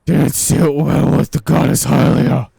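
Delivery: slow, loud Batman-like demon; a brooding cursed statue speaking. Acted.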